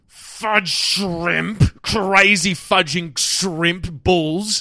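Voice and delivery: slow, high mock swearing rant; swearing tirade. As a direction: fake swear words spat out one by one. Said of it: "replace every swear word".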